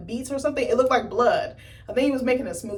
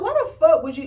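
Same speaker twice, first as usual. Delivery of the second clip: incredulous outburst; 8 semitones higher, 8 dB louder than usual. Retold or imitated. Yelling at the screen in disbelief.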